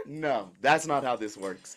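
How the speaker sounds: usual speaking voice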